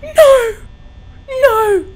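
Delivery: high, loud cries of fear; terrified shouts. Real, not acted. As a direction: scream no at the threat. Monster approaching in game.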